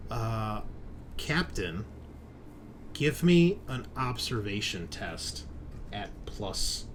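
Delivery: ordinary talk, nothing marked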